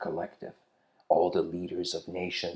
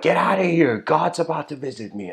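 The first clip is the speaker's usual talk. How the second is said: much higher and louder, a sharp shout; angry shout; 7 semitones higher, 7 dB louder than usual. Retold or imitated. Lady at the door shouting a stranded man away. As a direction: drive him off the doorstep.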